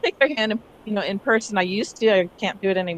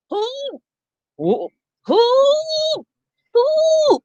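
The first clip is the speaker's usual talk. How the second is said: strained squeak, the last one much higher; breathless attempt to call. Teacher showing a call that cannot carry, squeaky. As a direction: try to call with no vowel, no breath. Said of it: "I had no breath".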